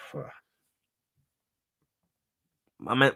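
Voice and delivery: ordinary talk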